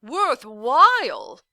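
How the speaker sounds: a furious bellow of that single word; indignant roar. A narrator's reading. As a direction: roar it back in disbelief. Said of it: "the man roared indignantly".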